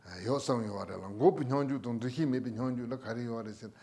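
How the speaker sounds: ordinary talk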